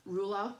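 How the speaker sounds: "Ruler" ending in an open ah; Australian schwa ending. Shown as a demonstration. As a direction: say "Ruler" with the final R dropped. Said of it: "trying to think of other words".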